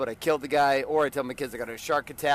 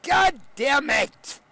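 slow, loud, higher curse; frustrated curse; 12 semitones higher, 7 dB louder, much slower than usual. Real, not acted. Fed up with another Achilles surgery, a loud curse. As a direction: bite out the curse slowly. Cursing about his surgery.

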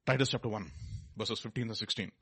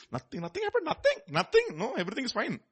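mock denial; 12 semitones higher than usual. Mocking a sinner's denial.

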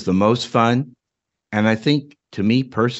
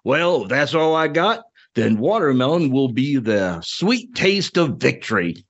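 put-on smug Southern old coot, pitched a little higher; Southern crotchety old man; 3 semitones higher than usual. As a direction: savor it like a cranky old Southerner. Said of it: "your Southern Ed Draw accent was perfect".

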